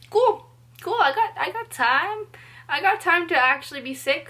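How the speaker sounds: high, loud mock-breezy voice; sarcastic relief. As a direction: fake it like the wait is a gift. Retold sarcastic inner reply.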